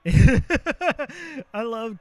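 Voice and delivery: loud laugh breaking into the words. Real, not acted. Streamer cracking up at his own joke. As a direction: burst out laughing as you speak.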